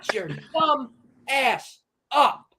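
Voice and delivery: high, slow, word-by-word telling off; drawn-out, high-pitched dismissal; exasperated put-down. Real, not acted. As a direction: space out each word scornfully. Tells absent father off.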